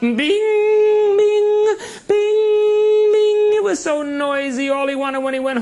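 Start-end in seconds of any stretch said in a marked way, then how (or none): 0.0-3.5 high, loud ringing bings like an anvil; hammer bings. Retold or imitated. Storyteller sounds the blacksmith's hammer, high and loud. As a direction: ring out each bing like a hammer on iron. Blacksmith pounding in his shop.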